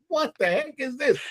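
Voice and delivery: high-pitched mock wail of disbelief; retold, half-crying dismay; mock tearful. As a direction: imitate an overwhelmed recruit, voice pitched high. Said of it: "we were just, like, crying".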